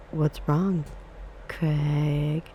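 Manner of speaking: taunting, with the name drawn out; mock them, stretching the name at the end; teasing question with a long-held name. A narrator's reading. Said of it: "David's mocking voice".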